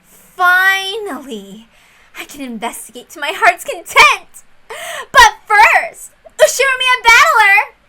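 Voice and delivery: high, loud triumphant cry; gleeful shout. Acted. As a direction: crow with delight, finally free. Erica gleeful outburst, voiced character.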